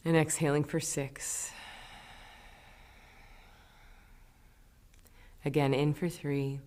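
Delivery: soft meditation-teacher voice, with long pauses; guided breathing. Acted. Teacher leading listeners through inhale and exhale, guided breathing. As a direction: gently count them through each breath, leaving room to breathe.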